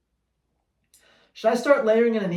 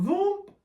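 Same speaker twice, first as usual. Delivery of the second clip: high whooshing car noise; mock car whoosh; car zooming past; 12 semitones higher than usual. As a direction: make the car zoom by high and fast.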